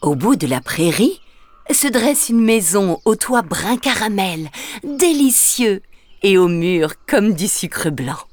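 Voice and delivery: plain reading voice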